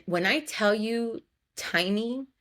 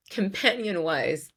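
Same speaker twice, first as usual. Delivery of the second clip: normal talking voice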